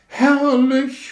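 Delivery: sehr hoch und laut verzückt geschrien; Schrei. Acted. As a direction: schrei es voller Wonne heraus. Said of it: "schrie sie". (Rakete schreit entzückt im Flug)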